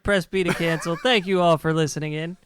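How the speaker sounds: booming radio announcer voice; loud DJ outro, big and bright. Real, not acted. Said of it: "Why are you yelling?".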